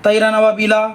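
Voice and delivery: quick, unstretched तैरन अबाबीला; rushed reading. Shown as a demonstration. Teacher showing students the hurried mistake. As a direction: say तैरन अबाबीला fast without lengthening. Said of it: "आप अगर जल्दी पढ़ के".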